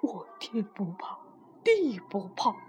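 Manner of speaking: low, whispery confession; cowed wall speaking very softly; small hushed voice. A narrator's reading. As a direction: shrink the boast to a near whisper. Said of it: "墙小声地说".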